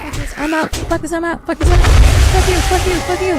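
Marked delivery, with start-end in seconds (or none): 1.5-1.9 yelled at the screen, much louder; loud outburst. Real, not acted. Gamer fleeing the monster, yelling it out loud. Repeating the line, escalating. 2.3-3.3 loud furious cursing at the game; angry yelling. Real, not acted. Gamer cursing the creature attacking him, loud and angry.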